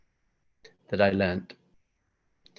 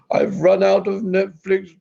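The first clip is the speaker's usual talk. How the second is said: high, slow, loud whining; over-the-top pleading falsetto; whiny plea; 10 semitones higher, 3 dB louder, much slower than usual. Pretend. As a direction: beg like a helpless drama queen. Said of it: "you gotta be like".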